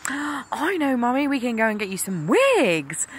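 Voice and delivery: a lightly voiced eager little boy; imitating son. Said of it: "he piped up and he said".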